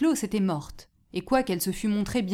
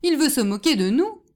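loud, higher exclamation; raised, protesting shout; indignant outcry; 5 semitones higher, 6 dB louder than usual. A narrator's reading. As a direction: cry out as an offended crowd. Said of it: "s'écria enfin tout le monde".

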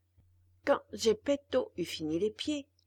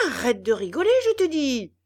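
loud, higher threatening yell; menacing yell; 3 semitones higher, 11 dB louder, faster than usual. A narrator's reading. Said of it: "hurla Geppetto d'une voix menaçante".

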